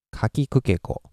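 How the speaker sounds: ka, ki, ku, ke, ko run together at even volume; level, connected ka-ki-ku-ke-ko; flat Japanese syllables. Shown as a demonstration. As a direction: say ka, ki, ku, ke, ko evenly, no syllable stressed. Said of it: "is flat".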